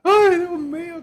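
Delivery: high mock wail of a sobbing guest. Preacher mocking a guest crying at a wedding, high wail. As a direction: squeal it like a whiny mourner.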